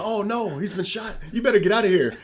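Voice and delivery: mock alarm. Gang arriving at the staged shooting, mock alarm. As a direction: imitate a badly staged panic.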